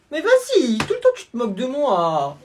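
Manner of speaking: high, loud whine calling for mum; little brother wailing to his mum after being mocked, loud high whine; whining kid. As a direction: bawl for mummy like a wronged little boy.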